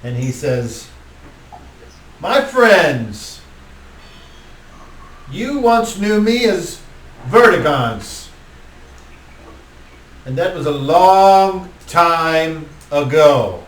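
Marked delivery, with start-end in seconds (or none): 2.2-13.6 somewhat higher, slow, grand address; lightly voiced vampire lord. Vertigance greeting his old friends, lightly voiced and grand. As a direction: announce it with a touch of theatrical gravity.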